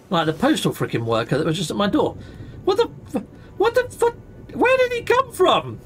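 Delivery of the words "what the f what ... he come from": high-pitched startled yelp, cut off; shocked. Real, not acted. Seeing a stranger pop up by the door, shrieking in shock. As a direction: squeak out the half-swear in disbelief.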